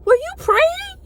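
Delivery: very high, loud question; exaggerated high-pitched surprise; imitated young woman. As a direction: mimic her in a squeaky high voice. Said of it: "She was like".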